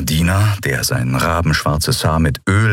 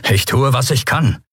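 complaining; 6 semitones higher than usual. Acted. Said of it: "beschwerte sich Telbo".

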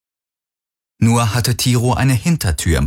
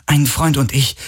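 quick, higher-pitched mild irritation; understated impatience, pitched up; audibly annoyed; 6 semitones higher than usual. A narrator's reading. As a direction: brush the question off, slightly irked. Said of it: "hörbar genervt von seinen Fragen".

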